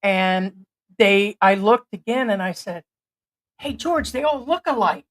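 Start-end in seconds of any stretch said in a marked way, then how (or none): none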